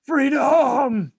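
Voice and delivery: high loud Scottish war cry; hammed-up Scottish shout of freedom; mock Scottish battle cry. Asked to try a Scottish accent, mock Scottish battle yell. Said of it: "Do you wanna try?".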